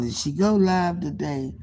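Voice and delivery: usual speaking voice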